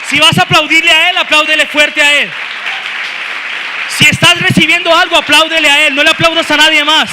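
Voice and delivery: loud, shouted exhortation; full-force preaching. Real, not acted. Preacher urging the church to applaud God, shouting at full force. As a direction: drive the congregation to applause.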